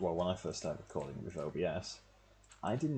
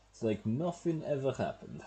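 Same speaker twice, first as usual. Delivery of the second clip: a light, hammed-up Russian accent on every word; joking Russian accent, a touch raised; mock Russian accent; 6 semitones higher, 4 dB louder than usual. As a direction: say it like a Russian villain gloating. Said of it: "Don't know why I put on the Russian accent there".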